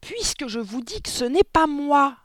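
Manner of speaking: high, very loud yell; exasperated screamed denial; full-lunged protest. A narrator's reading. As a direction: shout your innocence at full volume. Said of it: "s'époumonna Mathilda".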